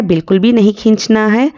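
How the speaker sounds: ordinary talk, nothing marked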